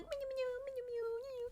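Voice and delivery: very high, very soft squeaky little noises; tiny dog. Retold or imitated. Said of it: "small dog, he's gonna be like".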